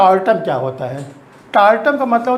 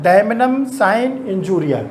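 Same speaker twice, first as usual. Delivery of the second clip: each Latin word spaced out very slowly for notes; slow dictation; much slower than usual. Shown as a demonstration. Teacher slowly dictating the first maxim to students. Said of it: "दूसरा maxim क्या है?".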